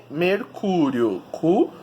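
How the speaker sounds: "mercúrio" very slowly, CÚ drawn out; stretched stressed syllable in "mercúrio". Shown as a demonstration. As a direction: call out "mercúrio" to find the CÚ. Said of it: "descobrir qual é a sílaba tônica".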